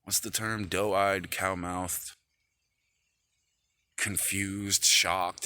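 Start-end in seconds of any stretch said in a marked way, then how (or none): none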